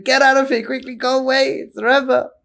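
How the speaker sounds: frantic urging. Retold or imitated. Chassid rushes his lady friend out before the Rebbe, frantic. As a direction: shoo her out in a panic.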